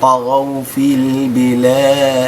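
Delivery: slow, melodic tajwid chanting; Quran recitation. Shown as a demonstration. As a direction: chant the verse slowly. Recites the verse. (ترتيل)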